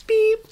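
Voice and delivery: very high electronic beep; one loud, high machine beep; imitated beep. Imitates vacuum cleaner beeping.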